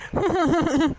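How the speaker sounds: high-pitched stagy sobs; acted sobbing. Daughter-in-law breaking down before the neighbour women, mock sobbing. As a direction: burst into wordless, put-on crying. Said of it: "எதுக்குடி இப்ப அழுகுற".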